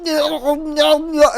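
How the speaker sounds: high-pitched incoherent moaning; hammed-up delirious wail; mock overdose babble. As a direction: imitate someone wasted on the floor. Mimics someone overdosed on the floor.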